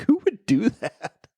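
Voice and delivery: high, drawn-out disbelief; incredulous. Retold or imitated. Recalling reaction to underwear on heads, amused incredulity. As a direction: stretch it out high, half laughing.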